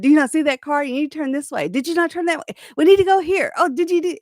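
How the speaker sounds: imitating her own bossy backseat driving; mock nagging at the driver. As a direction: nitpick his driving, pitch climbing. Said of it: "I complained about his driving".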